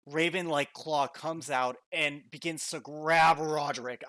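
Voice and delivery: slow, building dramatic narration; dramatic scene narration. A narrator's reading. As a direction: paint the spectral claw slowly. GM narrates the summoned claw.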